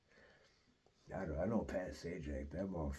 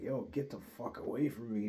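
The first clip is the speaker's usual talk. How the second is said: retold rebuff; 5 semitones higher, 4 dB louder than usual. Retold pushing the man away.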